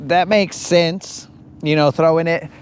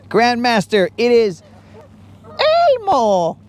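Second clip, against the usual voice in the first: failed Elmo voice; 5 semitones higher than usual. Pretend. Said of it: "Wow, that was terrible".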